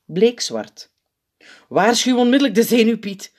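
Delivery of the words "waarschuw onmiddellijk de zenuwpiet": loud, high urgent order; bevelend. A narrator's reading. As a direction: give an immediate order. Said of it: "beveelt hij de P V G". (dringend bevel)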